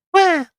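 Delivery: shrill startled squeal; high yelp. Acted. Character yelps at threat.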